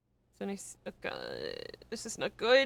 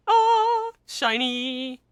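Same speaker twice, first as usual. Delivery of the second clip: mock awe; 4 semitones higher, 8 dB louder, much slower than usual. Joking about a bald head.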